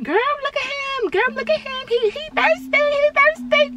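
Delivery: very high, squealy gossip voice; mocking girlfriend voice. Laughing with friends about the boy, in a squeaky high voice. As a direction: shriek it to your friends like a giggling teen. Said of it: "I'm like".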